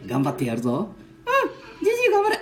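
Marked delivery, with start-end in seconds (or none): 1.3-2.4 put-on high, playful little-boy voice; squeaky grandkid voice; child voice. Ken-chan cheering.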